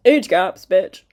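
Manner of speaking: loud, sudden punchy callout; punchline jab. Real, not acted. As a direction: slam the joke home.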